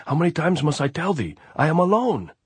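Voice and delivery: loud, high, fed-up yell; exasperated shout. A narrator's reading. Andres fed up with the sentry's questions, angry shout. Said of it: "Andres shouted".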